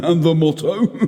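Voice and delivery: put-on high, giggly drunk nobleman asking; drunken Fortunato. A narrator's reading.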